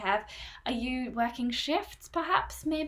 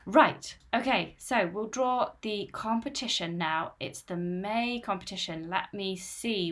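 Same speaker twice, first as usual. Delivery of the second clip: ordinary talk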